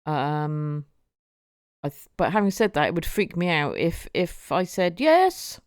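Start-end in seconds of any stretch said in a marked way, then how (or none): 5.0-5.6 high, loud yes toward the door; called-out yes. Pretend. Answering a knock at the bedroom door, called out high and loud. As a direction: call out to whoever knocked.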